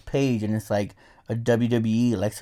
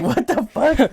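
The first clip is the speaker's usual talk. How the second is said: mock shock; 11 semitones higher, 3 dB louder, slower than usual. Reaction to porn post.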